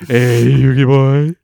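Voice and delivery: loud, drawn-out posh villain call; slow, hammed-up cartoon villain; Maximilian Pegasus. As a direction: stretch it out in a theatrical British voice. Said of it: "Maximilian Pegasus".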